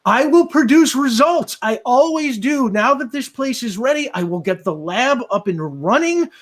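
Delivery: high, loud, strained screech; screeching torture victim. Acted. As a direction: screech it out as a desperate captive under torture. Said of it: "screeches, and he's like".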